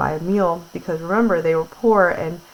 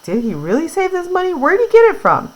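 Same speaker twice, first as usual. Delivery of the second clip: surprised; 11 semitones higher, 4 dB louder than usual. Acted. Paraphrased grandpa in disbelief.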